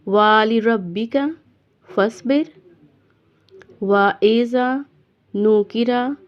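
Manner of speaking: slow, measured recitation, phrase by phrase; deliberate teaching recitation with pauses between phrases; Quran recitation lesson. Shown as a demonstration. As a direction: recite for learners with long held vowels. Teacher recites verses slowly.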